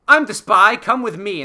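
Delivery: bold reveal. Retold or imitated. Podcaster voicing Hux revealing he is the spy, loud and hammed-up. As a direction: announce it loud and proud. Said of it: "He's like".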